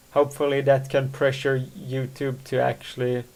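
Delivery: ordinary talk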